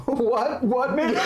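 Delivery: high, comic startled dude; hammed-up panicky slacker voice; alarmed dude. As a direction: yelp it in mock alarm. GM voices terrified civilian.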